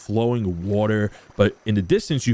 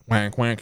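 friendly duck quacks. Acted. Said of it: "He plays".

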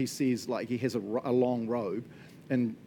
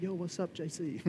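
a higher, soft, slangy "yo, what's up"; breezy teen high-five greeting, half-mocking; lightly voiced youth, tossing off a hi; casual youth greeting; 4 semitones higher, 7 dB quieter than usual. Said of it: "heaps of our youth say, and high-five him and be like".

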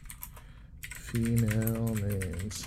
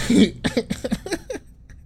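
laugh; 14 semitones higher, 6 dB louder than usual. Real, not acted. High laugh.

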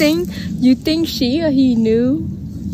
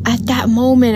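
ordinary talk

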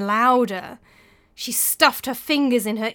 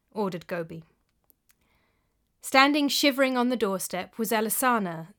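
ordinary delivery

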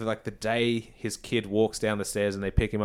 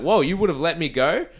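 put-on higher, excitable kid's outburst; mock bad kid; 7 semitones higher, 4 dB louder than usual. Kid learns he could have left, mock kid outburst. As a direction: play the kid's sudden turnaround, pitched up. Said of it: "the kid's like".

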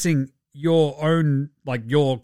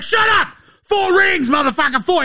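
high-pitched, loud outburst; hammed-up furious shouting; 4 dB louder than usual. Clay boasting at his critics, hammed-up furious shouting. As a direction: yell it as an over-the-top indignant athlete.

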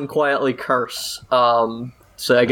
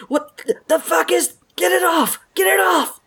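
put-on panicked kid. Kid panicking at unseen grip.

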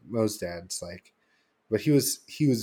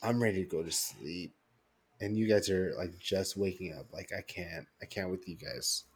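worn-out grumble; 6 dB quieter than usual. Retold or imitated. Too tired for the club scene.

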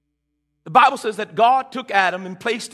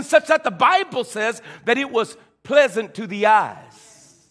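a touch louder, rising preacher's drive; preaching; 5 semitones higher, 5 dB louder than usual. Acted. Pastor preaching to his congregation, building fervor. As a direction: press the point home to the church.

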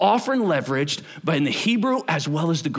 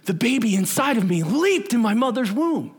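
Elizabeth's voice; 6 semitones higher than usual. Retold or imitated. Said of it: "Elizabeth says to Mary".

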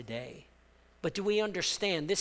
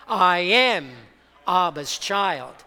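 bold declaration; 10 dB louder, slower than usual. Shown as a demonstration. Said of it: "Let me hear you say that this morning".

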